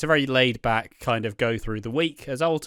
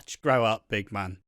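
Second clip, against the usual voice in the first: ordinary delivery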